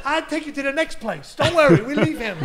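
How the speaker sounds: high, loud, laughing; mock eager butcher. Butcher pulling the actor to the next shop, high and eager. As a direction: drag him along eagerly.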